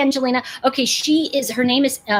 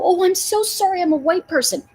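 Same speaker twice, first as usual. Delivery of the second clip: higher, simpering mock-sorry voice; mock apologetic; 7 semitones higher than usual. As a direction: parody a guilty, fawning apology. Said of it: "and that go".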